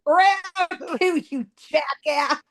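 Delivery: high playful taunt, dropping at the end; mock challenge. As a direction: goad him into a rematch. Acted challenge to cousin's ghost.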